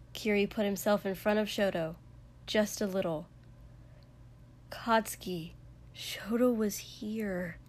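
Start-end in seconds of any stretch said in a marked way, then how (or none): 4.7-7.6 slow, gentle reasoning; quiet, careful and unhurried; softly. A narrator's reading. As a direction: say it gently to calm someone. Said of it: "Kiri said softly".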